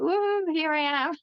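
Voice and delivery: high, singsong whoop of arrival; playful self-parody. Retold or imitated. Coach re-enacting turning up to client sessions in pajamas, playful high whoop. Mimics own cheery entrance on calls.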